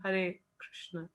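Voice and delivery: हरे Krishna said slowly, each syllable हरे drawn out and separate. Shown as a demonstration. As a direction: chant हरे Krishna with attention on every syllable. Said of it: "you can say like हरे". (हरे कृष्ण को धीरे, हर अक्षर अलग करके)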